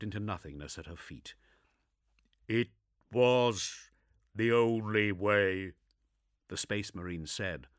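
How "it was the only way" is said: slow, flat gruff voice; unapologetic. Acted. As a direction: state it without regret.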